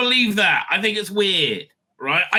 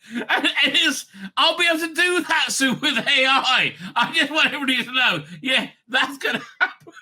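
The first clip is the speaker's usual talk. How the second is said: high, laugh-filled announcement; laughing while talking; 5 semitones higher than usual. Real, not acted. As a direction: talk through real laughter, pitched up. Cracking up at own joke.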